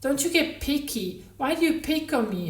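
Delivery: high, loud, whiny self-pity; whining child's tone. A narrator's reading. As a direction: mimic a sulky child to needle him. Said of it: "the whining, self-pitying tone".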